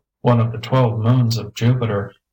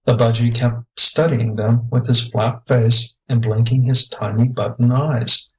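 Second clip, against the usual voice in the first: ordinary delivery, nothing marked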